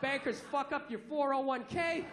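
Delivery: ordinary talk, nothing marked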